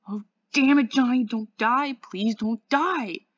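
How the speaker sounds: loud, high, anguished cry; desperate begging. A narrator's reading. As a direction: plead at full voice, drawing out the last words. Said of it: "he begged".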